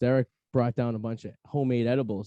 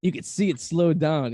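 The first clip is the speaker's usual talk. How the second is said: stoned cousin; 4 dB louder than usual. Retold or imitated. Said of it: "he looks at me, he's like".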